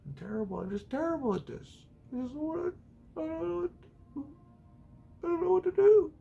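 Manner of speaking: high, soft, flustered squeak; a panicked little voice, not knowing what to do; mock frantic. Vlogger mocking himself in a panicked squeak. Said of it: "we're just gonna go on".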